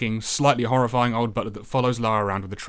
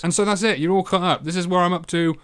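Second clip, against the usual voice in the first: ordinary delivery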